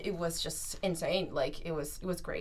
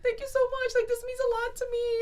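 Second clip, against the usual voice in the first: high, tearful gushing thanks. Retold or imitated. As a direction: thank her through happy tears. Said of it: "I just start crying".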